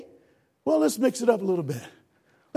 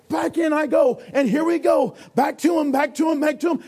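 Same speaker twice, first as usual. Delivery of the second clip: higher, louder, pushing back into the water; excited rescue; 4 semitones higher, 3 dB louder than usual. Acted. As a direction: shout as he dives back in. Lifeguard swims back again.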